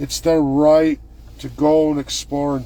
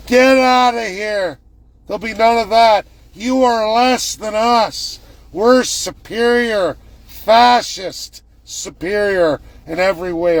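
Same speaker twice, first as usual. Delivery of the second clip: higher, louder sneering tyrant; mock arrogant premier; 7 semitones higher, 5 dB louder than usual. Premier dismissing Albertans, hammed-up sneer. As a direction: play the smug bully. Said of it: "That's his answer today".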